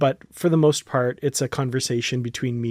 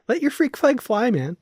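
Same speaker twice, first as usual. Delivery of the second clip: encouraging wife; 10 semitones higher than usual. Retold or imitated. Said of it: "she'd be like".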